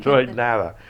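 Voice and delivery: laughing it out in disbelief. Real, not acted. Laughing at the lack of internet.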